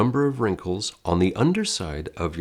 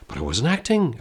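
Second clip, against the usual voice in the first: a raised, lightly voiced protest; mock the student's denial; 6 semitones higher than usual. Teacher voicing an imagined student denying they acted, mild mock protest. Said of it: "I hear you say".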